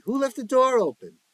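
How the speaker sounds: high loud yell; yelled question. Acted. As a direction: shout it across the room. Joey yelling in chaos.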